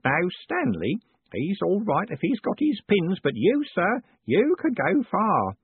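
put-on high, slow, wavering old postman voice; old Grote. A narrator's reading. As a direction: play a frail old man, pleased.

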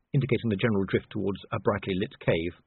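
plain reading voice

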